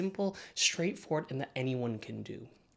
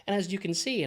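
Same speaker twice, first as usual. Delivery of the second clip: ordinary delivery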